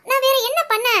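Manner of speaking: ordinary talk, nothing marked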